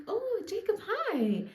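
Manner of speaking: high, bright-pitched surprised greeting; mock surprised hi; surprised girl. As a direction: squeak out a startled hello. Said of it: "And she's like".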